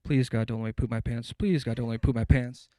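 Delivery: pleading shout, low and strained; loud panicked praying; desperate loud prayer. Retold or imitated. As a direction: beg God at the top of your lungs. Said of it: "I start to pray".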